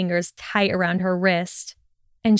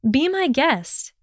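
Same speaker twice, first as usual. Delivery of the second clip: higher-pitched, tart comeback; quick retort; 7 semitones higher, slower than usual. A narrator's reading. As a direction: toss the retort back at him. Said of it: "she retorted quickly".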